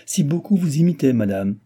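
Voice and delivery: high, loud burst, dropping at the end; spirited exclamation. A narrator's reading. Madame de Guéménée urging on the duchess, spirited cry. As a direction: cry it out eagerly. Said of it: "s'écria madame de Guéménée".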